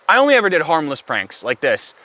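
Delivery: ordinary talk, nothing marked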